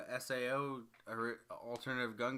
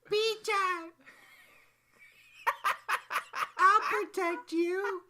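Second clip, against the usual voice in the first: high, slow squeaky cute voice; fake cute pouty voice; 11 dB louder, much slower than usual. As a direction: squeal it like a kawaii anime gun. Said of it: "talked to them in a kawaii voice".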